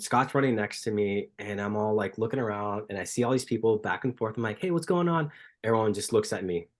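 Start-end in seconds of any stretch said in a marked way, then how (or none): 4.6-5.3 high, chirpy retold hello; acted friendly greeting. Runner hailing strangers in San Francisco, acted high greeting. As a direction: lift it well up, imitating a friendly hail.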